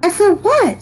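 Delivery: put-on high, loud child voice; impatient child listener. A narrator's reading. Abilene interrupts the storyteller, impatient high child.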